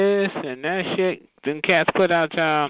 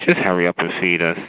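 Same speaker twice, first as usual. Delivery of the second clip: lower, demanding cat voice; voicing the cats; 9 semitones lower than usual. Cats demanding food from owner, low impatient voice. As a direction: bark it like hungry cats. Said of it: "They be looking at".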